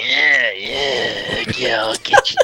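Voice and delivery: strangled, straining mock taunt; choked opponent. Storyteller acts the man pinned by an elbow, choked and straining. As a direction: play a pinned fighter straining out threats.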